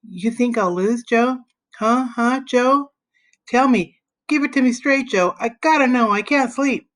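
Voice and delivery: put-on high, urgent boyish pleading; anxious pleading. A narrator's reading. Sleepless Alan begging Joe for the truth, anxious.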